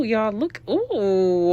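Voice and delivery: normal talking voice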